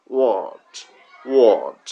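ওয়ার্ড with a long pressed ur; model pronunciation. Shown as a demonstration. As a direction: say ওয়ার্ড slowly for copying. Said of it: "এই অ সাউন্ডটা হয়ে যাচ্ছে".

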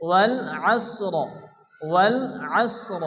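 আসর stopped on s and r joined, no final i; vowelless stop ending. Shown as a demonstration. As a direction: close আসর on a silent-vowel r. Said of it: "দুটি সাকিনযুক্ত অক্ষর একসঙ্গে সংযুক্ত করে".

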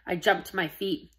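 plain reading voice

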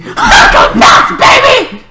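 loud, high manic whoop; going crazy. Pretend. Vlogger showing how crazy they can go, wild. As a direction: let rip in a wild, high shout. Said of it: "But if I can go crazy".

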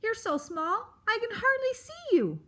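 high, testy creature voice; Aerith. Acted. Grump squints at the tiny mouse, exasperated. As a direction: rise into loud exasperation.

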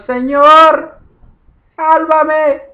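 very high, very loud desperate shout; crying out. A narrator's reading. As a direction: shout for rescue as if sinking. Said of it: "dio voces diciendo".

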